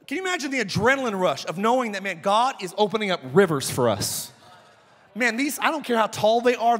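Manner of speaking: ordinary talk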